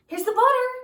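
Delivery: high, loud excited call; shouting Jack. Acted. Boy shouting to his mother that the butter is ready, loud and high. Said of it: "shouted Jack".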